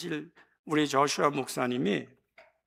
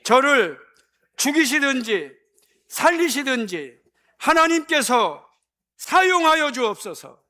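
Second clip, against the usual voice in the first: much higher and louder, slow fervent cry; loud group prayer; 9 semitones higher, 10 dB louder, slower than usual. Real, not acted. Pastor leading everyone in prayer, fervent and loud. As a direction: lead the congregation in a shouted prayer. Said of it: "다 같이 기도합니다".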